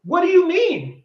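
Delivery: can't believe what he just heard; loud, high "what do you mean?"; incredulous. Real, not acted. Incredulous rant at her reply.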